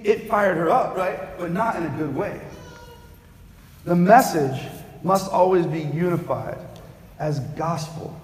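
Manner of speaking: ordinary talk, nothing marked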